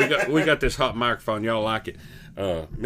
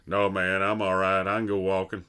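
lower, offhand Berman voice; lightly mimicked buddy, lower pitch; imitating Berman; 6 semitones lower than usual. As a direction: drop low and brush it off like your buddy. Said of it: "You're like".

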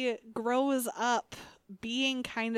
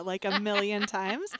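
ordinary talk

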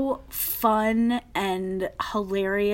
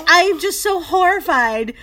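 raised, loud mock dismay; horrified; 7 semitones higher, 5 dB louder than usual. Imitating castmate telling the cast she is horrified, mock distress. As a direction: wail it in retold horror. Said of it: "like, screaming".

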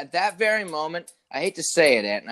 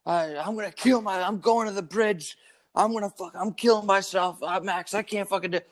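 higher-pitched mock desperation; imitating distraught client; 5 semitones higher than usual. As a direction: act out an overwrought caller in crisis. Retells client's suicide-threat phone call.

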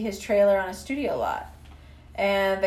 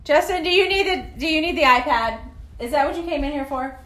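raised voice calling to her son; yelling across the room; 7 semitones higher, 5 dB louder, faster than usual. Real, not acted. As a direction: call out across the room, higher.

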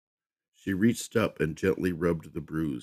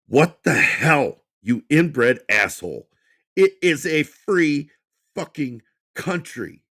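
much higher and louder, slow; furious shout; 11 semitones higher, 10 dB louder, slower than usual. Acted. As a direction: yell it in the manager's face, every word hammered. Michael shouts at the manager.

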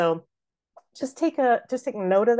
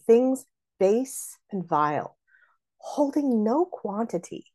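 slow acted verse, stab on "holding"; deliberate, felt delivery of "holding no quantity"; really feeling it; 5 semitones higher, slower than usual. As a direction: savor "base" and "vile", then jab HOL-ding. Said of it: "she's really feeling that".

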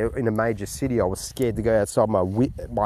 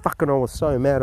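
usual speaking voice

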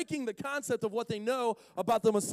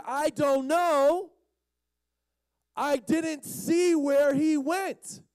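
high, loud, drawn-out exasperation; exasperated; 8 semitones higher, 5 dB louder, slower than usual. Acted. Healed blind man answering a stupid question, exasperated. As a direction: stretch each phrase in mock disbelief.

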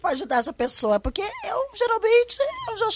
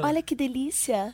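low, slow and soft; deep sultry voice; 4 dB quieter, slower than usual. Shown as a demonstration. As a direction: purr it out slowly in a low voice. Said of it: "também fazer essa voz".

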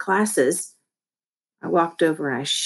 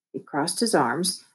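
usual speaking voice

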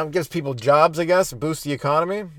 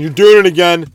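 much louder, a bit higher reproach; loud scolding friend; 5 semitones higher, 13 dB louder than usual. Retold or imitated. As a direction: call out a buddy who is hooked on the game. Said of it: "he kept on saying".

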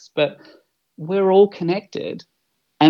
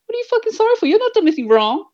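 high-pitched, playful indignant rant; cheeky high mock scolding that urges a friend to cry; mock exasperated outburst; faster than usual. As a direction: throw it out in mock outrage, voice jumping way up. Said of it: "It's like".

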